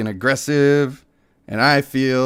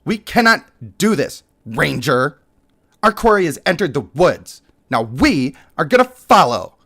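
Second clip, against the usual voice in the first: somewhat higher, firm challenge; lightly voiced haughty leader; 4 semitones higher, 3 dB louder than usual. Leader pushing the ranger onward, lightly voiced and firm. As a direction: press the ranger, a touch of disdain.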